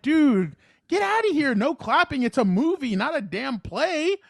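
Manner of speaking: high-pitched exasperated mock scolding; retold frustration. Moviegoer scolding people who clap at films, retold frustration. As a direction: tell them off in hammed-up, high-pitched annoyance. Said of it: "So I was getting frustrated".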